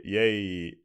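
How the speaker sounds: usual speaking voice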